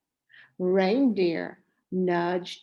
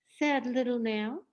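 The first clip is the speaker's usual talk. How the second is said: usual speaking voice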